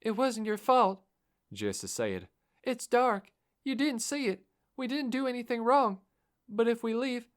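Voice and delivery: high, pleading reassurance; girl's voice. Acted. Girl reassuring the driver it wasn't his fault, high pleading voice.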